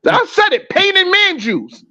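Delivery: squeaky high punchline; high mock voice. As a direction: pitch way up, slow and silly.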